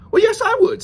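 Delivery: very high, loud pig voice; eager little pig. Acted. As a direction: squeal an eager yes as the pig. Pig eagerly accepts the wolf's offer.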